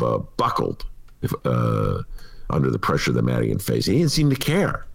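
normal talking voice

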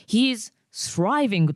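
"He is thriving" said very slowly; slow model line. Shown as a demonstration. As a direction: spell out "He is thriving" slowly word by word. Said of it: "って言って、".

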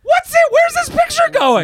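very high, loud squawk of disbelief; hammed-up high-pitched protest; mock outrage. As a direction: shriek it in comic exasperation. Retelling outrage at mom's photo.

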